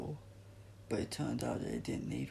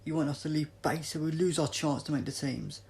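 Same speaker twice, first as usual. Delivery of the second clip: hostile challenge; 4 semitones higher, 7 dB louder than usual. Acted.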